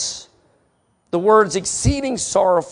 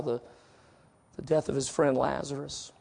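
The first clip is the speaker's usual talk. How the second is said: normal talking voice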